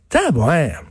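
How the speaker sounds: ordinary talk, nothing marked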